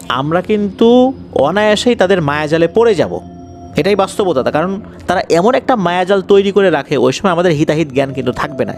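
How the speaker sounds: usual speaking voice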